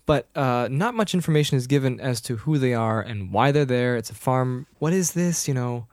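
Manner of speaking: usual speaking voice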